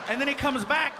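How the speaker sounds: usual speaking voice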